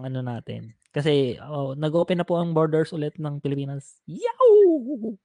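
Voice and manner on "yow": high, loud Yow; excited shout. Real, not acted. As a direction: cheer it out. Borders reopened.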